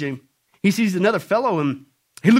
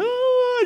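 high, held "oh"; comic high wail of dismay; mock wail. Mocking each rapture position.

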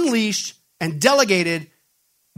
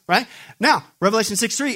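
ordinary talk

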